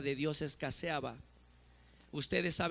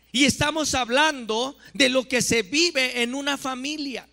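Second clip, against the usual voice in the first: louder, higher, forceful preaching; prédica a toda voz; 7 semitones higher, 8 dB louder than usual. Real, not acted. (predicación enérgica a voz alta)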